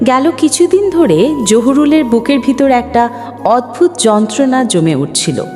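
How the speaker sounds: usual speaking voice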